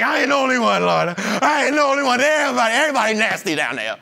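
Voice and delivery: rat everybody out, half laughing; tattling Isaiah. Retold or imitated.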